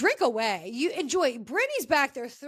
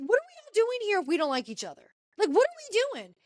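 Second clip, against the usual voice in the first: high-pitched imitation of indignant questioning; mock exasperation; 8 semitones higher, faster than usual. Mary challenges women who don't like each other, mock exasperated.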